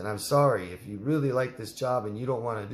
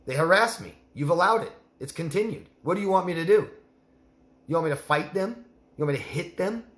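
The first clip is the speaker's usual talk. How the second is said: much higher and louder, exasperated and fed up; heated confrontation; 6 semitones higher, 4 dB louder than usual. Pretend. Speaker acts out telling the boss off, raised angry voice. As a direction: throw the frustration back at him, rising to a near-shout.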